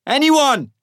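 high, loud call for survivors; searching shout. A narrator's reading. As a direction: shout out for anybody left alive. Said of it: "I shout".

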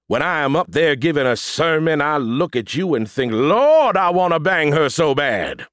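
hammed-up Southern preacher, building to an outburst; awful Southern accent. A narrator's reading. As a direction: preach lust like a Southern pastor. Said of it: "putting on my awful Southern accent".

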